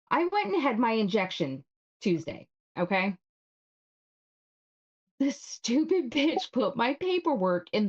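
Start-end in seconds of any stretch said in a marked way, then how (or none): none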